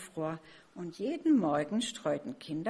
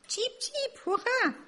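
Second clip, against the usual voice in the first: cheering chicks; slower than usual. A narrator's reading. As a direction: squeal like excited baby birds. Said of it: "schrien die Kinder". (hohes, jubelndes Vogelkinder-Geschrei)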